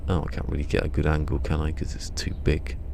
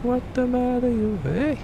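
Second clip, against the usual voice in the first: very high, slow squeaky cartoon voice; hammed-up squeaky wise guy; squeaky falsetto taunt; slower than usual.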